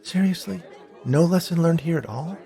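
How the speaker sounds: high, incredulous questioning; frustrated, pitch leaping up in disbelief. A narrator's reading. Bee girl to Harrison, who learned no lesson, frustrated.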